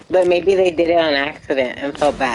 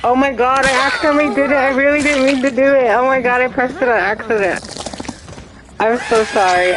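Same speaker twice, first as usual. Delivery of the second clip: blurting out in real panic; flustered panic; 6 semitones higher than usual. Real, not acted. Accidentally hit a teammate.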